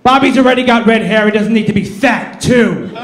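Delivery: a hint of the mother snapping back in a fight; mom. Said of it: "My mom goes".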